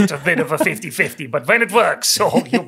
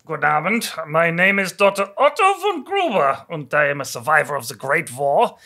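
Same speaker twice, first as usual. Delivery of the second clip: put-on lightly German accented doctor. Plays German doctor, good Abend.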